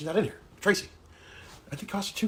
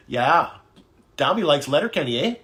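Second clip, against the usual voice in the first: mock Canadian with a big "eh"; hammed-up Letterkenny Canadian; Canadian accent; 5 dB louder than usual. Teasing Canadian fans with mock Canadian accent. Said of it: "That's you guys, my Canadian".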